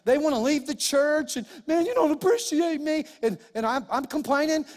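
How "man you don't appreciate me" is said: high, loud whining voice, much higher than his own; whiny complaint. Retold or imitated. Complaining to God in a whiny voice. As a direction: whine like a sulking servant.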